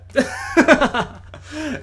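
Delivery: a short loud burst of delighted laughter. Real, not acted. Host enjoying his Skype chat with a fellow nerd, loud delighted laugh. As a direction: laugh out with real joy.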